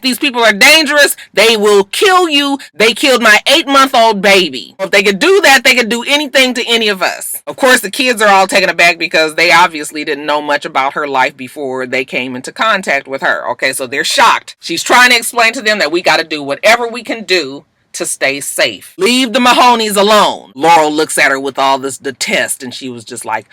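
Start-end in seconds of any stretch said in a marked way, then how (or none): 0.0-7.4 put-on higher, louder urgent warning to the kids; Annalise's stern warning. Annalise warning the kids off the Mahoneys, urgent and raised. 19.0-20.5 raised, firm command; stern order. Retold or imitated. Annalise ordering the kids to back off, firm and raised.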